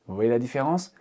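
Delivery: usual speaking voice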